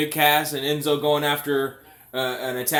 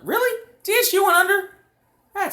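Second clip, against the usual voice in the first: shocked disbelief; 5 dB louder than usual. Real, not acted. Shocked at news in chat.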